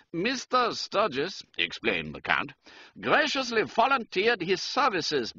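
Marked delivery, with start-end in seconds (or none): 0.1-1.4 higher-pitched German count; German-accented aristocrat, pitched up. A narrator's reading. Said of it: "He spoke with a German accent". 3.0-5.3 higher-pitched German count; German-accented aristocrat, pitched up. A narrator's reading. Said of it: "He spoke with a German accent".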